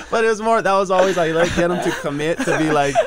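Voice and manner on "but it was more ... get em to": high, loud, through laughter; real laughing agreement. Real, not acted. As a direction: agree while cracking up. Cracking up at the joke.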